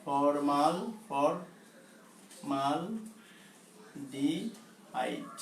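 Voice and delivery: Formaldehyde very slow and soft, in spaced syllables; slow dictation. Shown as a demonstration. Teacher dictating a long chemical name to students, slow dictation. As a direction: break formaldehyde into long, low-key pieces. Teacher sounds out the term slowly.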